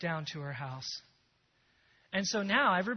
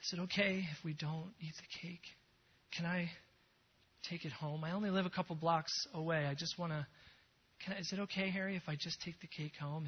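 much softer, hushed pleading; soft tearful asking; 10 dB quieter than usual. Retold or imitated. Agnes quietly asks Harry if she can take the cake home, soft. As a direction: ask gently, almost afraid to. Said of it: "she says".

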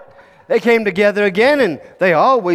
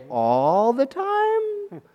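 very slow, softer, rising disbelief on "All the time"; incredulous question; 5 semitones higher, 6 dB quieter, much slower than usual. Acted. Little king echoing his advisor's claim, incredulous. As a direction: stretch "All the time" upward, doubting.

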